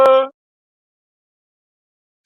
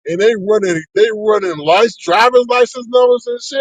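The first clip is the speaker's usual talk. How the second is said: normal talking voice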